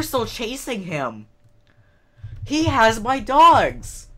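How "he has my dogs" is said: loud, slow, dismayed cry; louder, drawn-out horrified outburst; alarmed cry. Real, not acted. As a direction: wail it out as the dogs go missing. Realising the mob took her dogs.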